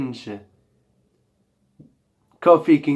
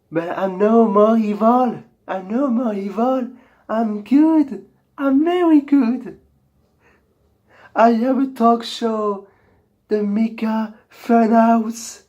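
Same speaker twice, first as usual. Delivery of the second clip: pitched-up, sweet good-guy voice; high nice-guy voice; 6 semitones higher than usual. Pretend. Host insists he is no longer evil, high nice voice. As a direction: sound harmless and nice, up high. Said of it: "It's my true voice".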